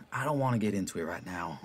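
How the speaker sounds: ordinary talk, nothing marked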